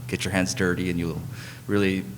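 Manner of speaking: ordinary delivery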